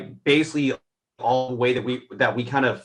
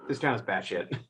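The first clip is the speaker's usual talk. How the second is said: dry, softer retold deadpan line; 3 semitones lower, 10 dB quieter than usual. Cohost retelling the actress's line, softer mock deadpan. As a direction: toss it off dry and unbothered, quieter. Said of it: "It's just, like, so deadpan".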